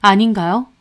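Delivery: plain reading voice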